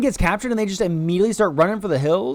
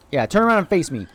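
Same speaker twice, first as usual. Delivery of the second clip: usual speaking voice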